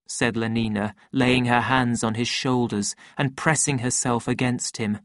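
ordinary delivery

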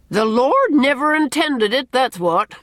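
high, loud pronouncement; emphatic, no-argument declaration; authoritative. A narrator's reading. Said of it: "said Missus Rachel authoritatively".

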